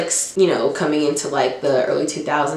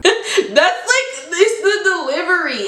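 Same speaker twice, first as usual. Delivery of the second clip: laughing; 11 semitones higher than usual. Real, not acted. Laughing at the clip.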